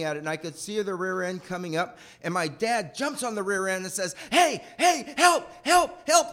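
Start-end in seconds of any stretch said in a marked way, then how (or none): 4.3-6.3 high, loud shouted calls for help; calling for help. Retold or imitated. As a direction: shout for help while holding the car. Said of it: "like that".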